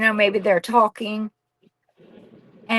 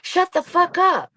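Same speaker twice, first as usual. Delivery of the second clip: mock yell; 8 semitones higher than usual. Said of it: "I just yelled at her".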